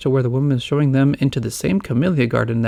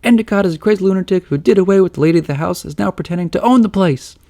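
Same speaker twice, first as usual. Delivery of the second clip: higher, louder conspiratorial rush; excited accusation; 7 semitones higher, 5 dB louder than usual. A narrator's reading. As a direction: blurt out the accusation. Fred accuses loudly.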